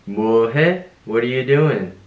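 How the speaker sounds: ordinary delivery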